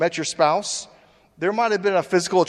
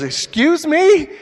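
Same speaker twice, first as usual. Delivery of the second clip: high, slow outraged excuse me, much louder; indignant shock; 12 semitones higher, 9 dB louder, slower than usual. Retold or imitated. Woman offended at being called a gold digger. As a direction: drag it out in offended disbelief. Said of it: "She goes".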